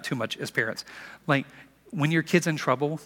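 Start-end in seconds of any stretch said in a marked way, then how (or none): none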